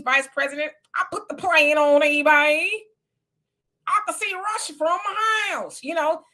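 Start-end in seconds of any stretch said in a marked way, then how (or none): 3.9-6.2 higher put-on Palin voice; imitating Sarah Palin. Host mocks Sarah Palin's famous line, lightly higher. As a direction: lightly mimic Palin's folksy brag. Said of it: "She was talking like that".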